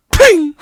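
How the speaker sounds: sudden high, loud "ping"; imitated metal ping. Storyteller mimicking the weld snapping, loud ping. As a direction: pop it out sharply.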